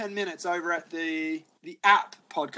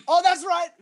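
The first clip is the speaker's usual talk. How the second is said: high excited exclamation; 11 semitones higher, 3 dB louder than usual. Retold or imitated. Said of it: "and we're like".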